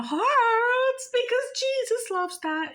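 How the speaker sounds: slow, very high, stretched-out urging; swooping up high, drawing out HEART; emphatic exhortation. Real, not acted. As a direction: sing it out high with feeling. Exhorts viewers playfully.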